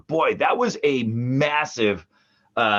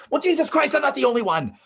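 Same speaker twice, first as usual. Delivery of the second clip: much higher mock retort; exasperated sister; 9 semitones higher, faster than usual. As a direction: toss off the sister's catchphrase. Callback to sister's salt line.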